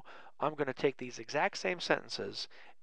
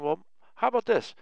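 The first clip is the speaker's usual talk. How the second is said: higher, louder, curious student question; imitating a student; 6 semitones higher, 6 dB louder than usual. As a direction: ask it like an eager student. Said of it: "now you're probably saying".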